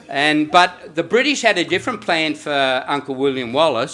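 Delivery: ordinary delivery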